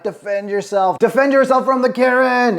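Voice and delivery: comic alarmed shout, rising in pitch; urgent call-out. Retold or imitated. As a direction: imitate hollering a warning to a friend. Calling out to friend being hit.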